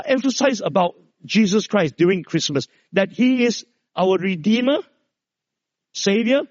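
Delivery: normal talking voice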